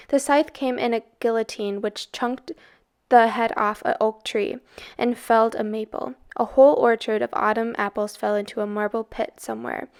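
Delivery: plain reading voice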